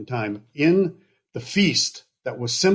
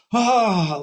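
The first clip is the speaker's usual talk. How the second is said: high, loud gasp of wonder; mock crowd gasp of amazement; amazed gasp; 10 semitones higher, 5 dB louder than usual. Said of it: "like that".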